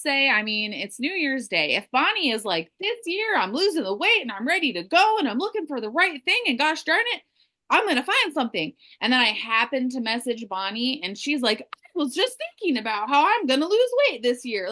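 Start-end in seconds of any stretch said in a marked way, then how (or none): 2.8-8.7 high-pitched, pumped-up determination; fired-up resolve. Acted. Acts out Bonnie's New Year's resolve. 11.6-14.7 high-pitched, keen imitation; eager agreement. Acts Bonnie's eager reply to message.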